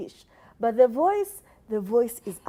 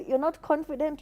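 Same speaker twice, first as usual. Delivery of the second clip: normal talking voice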